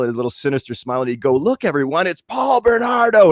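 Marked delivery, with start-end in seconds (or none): 1.4-3.3 mocking announcement. Coworker mocking him in the cafeteria.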